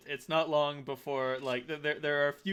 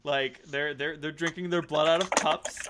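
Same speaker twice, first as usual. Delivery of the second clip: ordinary talk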